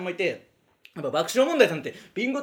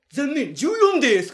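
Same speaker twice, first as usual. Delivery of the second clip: slow, louder, higher mock-mean reveal; teasing MC; 6 semitones higher, 6 dB louder, much slower than usual. The MC teasing a senior comedian, slow and mock-mean. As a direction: drag out the teasing letdown. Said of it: "意地悪しだした".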